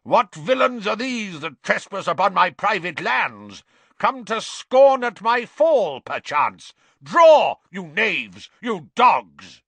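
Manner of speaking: loud, high, blustering knight in a rage; yelling knight. A narrator's reading. Little knight challenges trespassers on his private lands, yelling. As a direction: declaim like an outraged, old-fashioned knight, yelling. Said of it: "he yelled".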